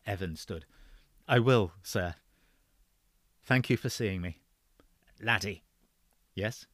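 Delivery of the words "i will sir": ordinary delivery, nothing marked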